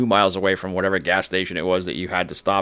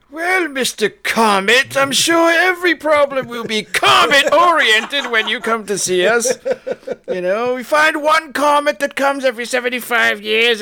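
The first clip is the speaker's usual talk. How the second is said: pompous Royal Society voice; 3 dB louder than usual. Retold or imitated. Host mocking the Royal Society scolding Halley, high stuffy voice. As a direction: ham up a snooty old scholar. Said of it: "Royal Society at the time was like".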